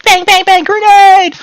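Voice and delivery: very high squealed pretend gunfire; imitated battle noises. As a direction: play soldiers, building to a loud cry. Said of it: "And they're like".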